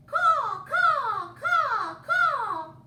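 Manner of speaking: loud harsh crow calls; crow caw. Acted. Reading crow call.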